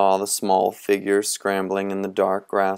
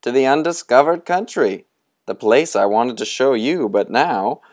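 high, looming, theatrical beckoning; sinister old storyteller; 5 semitones higher, 6 dB louder than usual. Acted. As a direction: lure the boys toward the dark. Moundshroud answers grimly.

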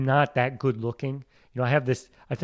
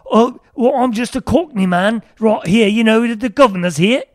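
high, loud hammed-up Cockney; Cockney accent; 11 semitones higher, 10 dB louder than usual. Shown as a demonstration. Guest showing Australian sliding into Cockney, loud broad accent. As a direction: belt it out as a pitched-up London Cockney. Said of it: "to Cockney really fast".

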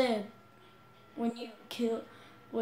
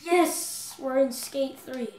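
excited; 3 semitones higher, 8 dB louder, much slower than usual. Real, not acted. Excited game start.

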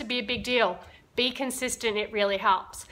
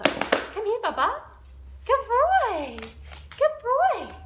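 high sing-song praise; dog praise; 12 semitones higher, slower than usual. Real, not acted. Trainer calling her dog over in a high sing-song.

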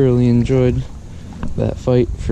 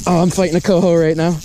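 higher and fast, thrilled mid-fight; excited; 6 semitones higher, faster than usual. Real, not acted. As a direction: blurt it out as the fish strikes. Fish just hooked while fishing.

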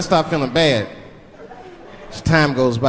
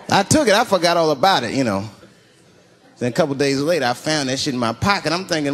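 ordinary talk, nothing marked

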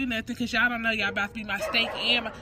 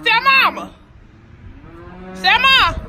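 high, loud call across the yard; 13 semitones higher, 12 dB louder than usual. Real, not acted. Daughter yells for stepmother about the chickens, loud call. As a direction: shout for her from far off.

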